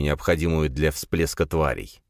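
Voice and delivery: plain reading voice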